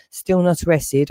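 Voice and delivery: usual speaking voice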